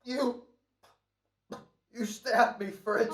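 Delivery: high, loud, halting shock; stunned and wounded. Acted. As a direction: gasp it out as you realize you're dying. Stabbed man accuses his killer.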